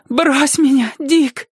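very high, strained call; high storybook plea of exhaustion; gasped cry. A narrator's reading. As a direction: cry out as if winded. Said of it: "крикнул он, задыхаясь".

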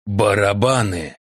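plain reading voice

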